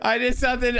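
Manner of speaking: words pushed out through real laughter; can't hold back the giggles while confessing; laughing while talking. Real, not acted. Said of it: "I'm doing it right now".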